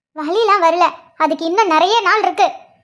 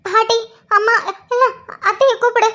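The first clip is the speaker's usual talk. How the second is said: gasping, broken pleas; in pain. Acted. Pregnant wife begs husband to fetch the family, in pain. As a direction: plead through waves of pain. Acted labour pain, begging for help.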